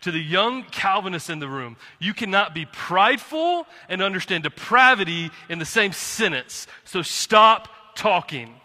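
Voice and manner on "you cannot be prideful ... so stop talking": real rising anger, hitting words hard; getting mad. Real, not acted. Preacher scolding the prideful young Calvinists in the room, getting mad. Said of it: "sorry, I'm getting mad".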